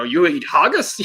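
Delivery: touch of put-on Scottish; mock Scottish accent. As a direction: ask it with a hint of Scottish brogue. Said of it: "sounds so Scottish saying".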